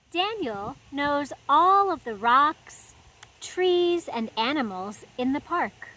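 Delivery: put-on lively read-aloud for little kids; storybook reading. A narrator's reading. Host reading a picture book to children, animated storytime voice. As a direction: read it like story time in the garden.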